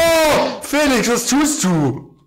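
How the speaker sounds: high, slow mock-dismayed cry at Felix; mock outrage. Gespielte Empörung über Felix. (gespielt entsetzt, hoch und langsam)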